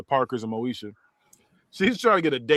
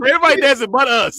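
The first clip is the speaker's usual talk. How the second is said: loud, high comic complaint; mock exasperation; 10 semitones higher, 9 dB louder than usual. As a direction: holler it high in mock outrage. Laughing retort voiced to the girl.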